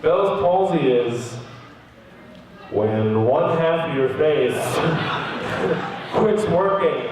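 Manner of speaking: low, slow doctor explanation; retold doctor. Doctor explaining the illness to patient, slow and low. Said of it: "He goes".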